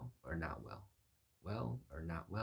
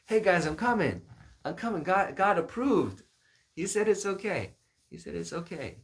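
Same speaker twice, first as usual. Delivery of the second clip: high, full shout to friends; excited calling out; 12 semitones higher, 14 dB louder than usual. Acted. Man calling to the guys that God approved, shouting. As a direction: holler it high and loud, hammed-up with glee.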